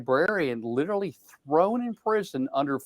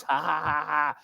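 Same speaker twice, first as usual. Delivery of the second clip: mock gloating laugh; 4 dB quieter than usual. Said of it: "And we own the libs".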